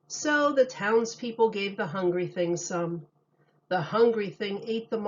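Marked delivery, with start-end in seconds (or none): none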